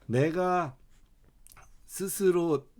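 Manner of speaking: ordinary delivery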